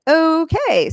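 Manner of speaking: high, drawn-out retold "okay"; taken-aback okay. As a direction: draw it out, as if humouring someone odd. Her retold reaction to his storming off.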